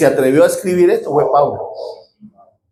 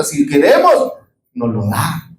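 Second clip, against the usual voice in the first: usual speaking voice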